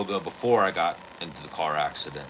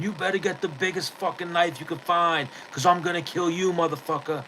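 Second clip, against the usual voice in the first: much higher retold threat, screamed without extra loudness; mock scream; 9 semitones higher than usual. Imitating the neighbor screaming threats at the son. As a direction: pitch it up like a raging neighbor. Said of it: "the guy starts screaming".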